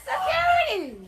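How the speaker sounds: high loud call for help; scared and angry. Real, not acted. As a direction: shout for security. Calling for security during scuffle.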